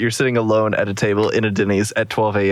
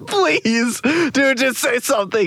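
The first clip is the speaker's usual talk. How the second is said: high begging through laughter; laughing plea; 11 semitones higher, slower than usual. Real, not acted. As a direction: plead while cracking up. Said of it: "I am bawling".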